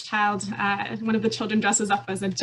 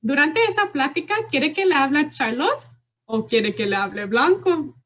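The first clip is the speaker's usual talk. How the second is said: higher, louder silly puppet voice; higher, louder funny puppet voice for a child; Blanco funny voice; 5 semitones higher, 4 dB louder than usual. Shown as a demonstration. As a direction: give the stuffed animal a goofy, playful voice to make kids laugh. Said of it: "And then I'll use a funny voice".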